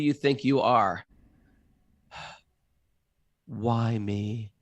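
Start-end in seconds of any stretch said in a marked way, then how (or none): 2.1-4.5 sighing lament. Retold or imitated. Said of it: "the mantras of the victim".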